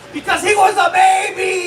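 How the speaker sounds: high, loud mock outrage; shouted punchline. Comic answering his own why, high indignant shout. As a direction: cry out the answer in exasperation.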